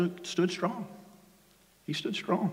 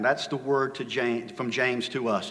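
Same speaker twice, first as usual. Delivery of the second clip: ordinary talk, nothing marked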